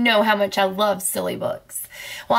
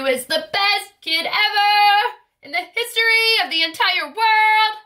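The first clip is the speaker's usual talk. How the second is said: much higher, louder, sung out with delight; sing-song praise; 9 semitones higher, 3 dB louder than usual. Pretend. Reader praising the listening kid, high and sung. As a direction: lilt it up high like a proud cheer.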